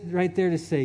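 ordinary talk

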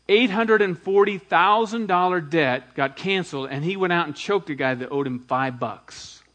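normal talking voice

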